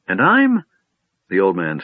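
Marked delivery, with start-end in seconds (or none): 0.1-0.6 put-on high, fussy old wizard; lightly voiced doddering old man.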